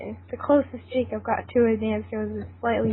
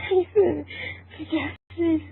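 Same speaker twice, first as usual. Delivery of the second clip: laughing; 6 semitones higher, much slower than usual. Real, not acted. Laughing at the line.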